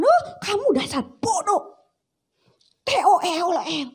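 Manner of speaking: hammed-up parent tirade; angry insult. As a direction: berate the child.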